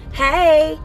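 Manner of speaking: ordinary delivery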